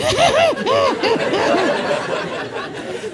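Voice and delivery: high stifled giggles; fighting laughter. Acted. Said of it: "trying to fight the laughter".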